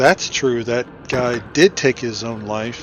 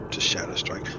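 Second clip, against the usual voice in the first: whisper; 5 semitones lower, 7 dB quieter, faster than usual. Real, not acted. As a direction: repeat it under your breath, unvoiced and softer. Repeats phrase, then whispers it.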